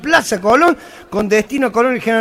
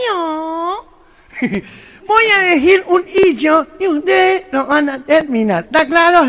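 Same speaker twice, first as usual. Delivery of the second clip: arenga nasal, algo aguda; da instrucciones como orador gangoso imitado; presidente gangoso; 4 semitones higher than usual. Acted. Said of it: "el presidente sube al pupit". (líder gangoso dirigiéndose a los suyos)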